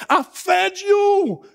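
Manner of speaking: high, drawn-out cry of reproach; acted indignant shout. Preacher voicing the rebuke Jesus withheld, indignant shout.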